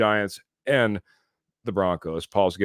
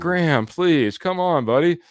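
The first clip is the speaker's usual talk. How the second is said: playful pleading; 8 semitones higher than usual. Real, not acted.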